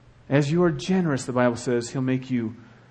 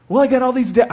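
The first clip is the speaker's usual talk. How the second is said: mock whiny excuse; 9 semitones higher, 8 dB louder, faster than usual. Mocking excuse voice.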